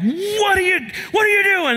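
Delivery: high-pitched retold yell; mock-furious dad shout, voice shooting up; yelling at the kids. Pastor re-enacting yelling at his kids after crushing his thumb, mock yell. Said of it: "I just yelled at the kids".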